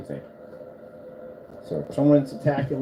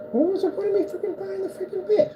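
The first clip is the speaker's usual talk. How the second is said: much higher squeaky complaint; exasperated mock voice. Retelling his bed complaint, high mock whine. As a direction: squeak out the frustration. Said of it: "I'm like".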